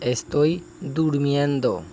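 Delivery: slow, careful Spanish, each syllable clear; slow model phrase. Shown as a demonstration. Teacher modelling a Spanish phrase for students, slow and careful. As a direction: model it slowly for learners. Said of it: "آگے فقرہ ہے".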